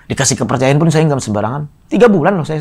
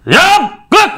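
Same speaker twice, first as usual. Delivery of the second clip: snappy call to attention; 4 dB louder than usual. Retold or imitated.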